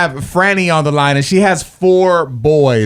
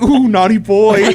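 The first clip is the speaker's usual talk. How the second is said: mock scolding; 6 semitones higher, 4 dB louder, slower than usual. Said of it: "I'm like".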